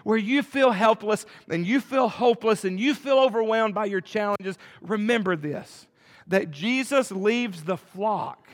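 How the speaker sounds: loud, high-pitched preaching; preaching at full force. Acted. Pastor urging the hopeless congregation, loud preaching. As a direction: drive the point home to the church.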